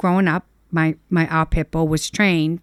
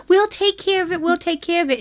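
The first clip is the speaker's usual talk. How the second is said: put-on begging child; 10 semitones higher than usual.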